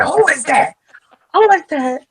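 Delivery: imitating his yelled who is that; hammed-up mock outrage, loud; retelling a man snapping at a woman, mock yell; mock angry. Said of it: "He was like".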